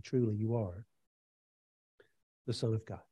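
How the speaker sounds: very soft, low, awed declaration; hushed reverence. Acted. Disciples worship Jesus in the boat.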